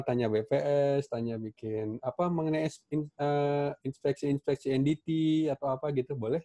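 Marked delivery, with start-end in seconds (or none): none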